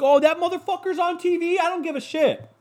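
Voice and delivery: high squeaky mock of himself, not caring. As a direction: squeak it out dismissively. Acted dismissive quote, high.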